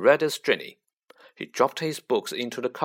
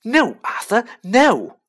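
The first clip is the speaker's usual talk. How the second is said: high, loud shriek; wife shrieking at her husband to stop fighting; 10 semitones higher, 9 dB louder, slower than usual. A narrator's reading. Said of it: "Missus Weasley was shrieking".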